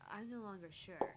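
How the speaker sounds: ordinary delivery, nothing marked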